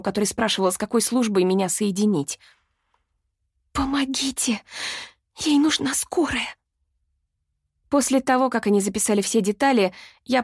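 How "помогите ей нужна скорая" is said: urgent whisper into a phone; hushed and urgent, breathy whisper. A narrator's reading. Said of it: "прошептала оператору". (срочный шёпот в трубку)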